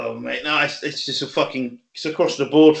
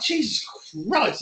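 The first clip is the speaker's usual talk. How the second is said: high strained exclamation; 7 semitones higher, 7 dB quieter, slower than usual. Retold or imitated. Exhausted after hack squat set.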